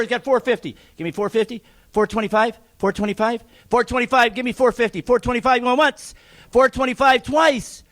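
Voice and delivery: rapid, rhythmic auctioneer patter pushing the bids; auctioneer bid calling. Acted. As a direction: keep the numbers rolling like a real auctioneer. Closing second lot.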